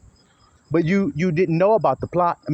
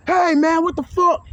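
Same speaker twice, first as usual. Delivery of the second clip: high, loud indignant yell; provoked outburst; 13 semitones higher, 4 dB louder than usual. Retold or imitated. Husband goaded by his wife in front of family, high loud outburst.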